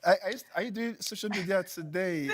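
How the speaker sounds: laughing attempt at a British accent. Teasing the social media host, attempted British accent. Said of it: "It's a British accent, obviously".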